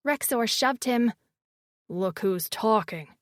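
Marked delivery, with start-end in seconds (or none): 1.9-3.2 deep male voice. A narrator's reading. Rexor retorts, deep slow voice.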